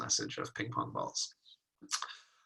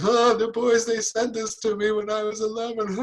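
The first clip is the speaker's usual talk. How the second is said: put-on higher, hurt little-kid voice for an old memory; hurt inner child; 9 semitones higher, 4 dB louder than usual. Someone replaying a childhood hurt, in a higher mock-hurt voice. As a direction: play the wounded eleven-year-old inside.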